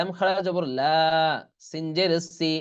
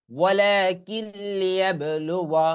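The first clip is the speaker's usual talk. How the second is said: slow, drawn-out Quran chant; Quranic recitation; 3 semitones higher, slower than usual. Shown as a demonstration. Teacher recites the joined word for the students after spelling. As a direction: recite it slowly and sustain the vowels.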